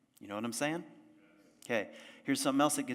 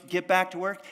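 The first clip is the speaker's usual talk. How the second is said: bossy command; 8 semitones higher, 8 dB louder than usual. Acted. Inner voices ordering you back.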